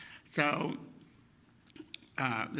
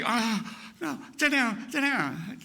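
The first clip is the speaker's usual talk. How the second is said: a higher, mildly alarmed mother; 6 semitones higher, slower than usual. Retold or imitated. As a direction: hurry him into a chair, mildly rattled. Shocked mother makes him sit.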